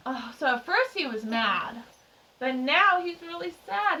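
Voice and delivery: ordinary delivery, nothing marked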